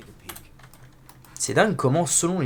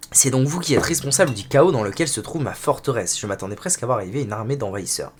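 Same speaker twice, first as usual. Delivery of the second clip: story narration. Acted. Reads game dialogue narration.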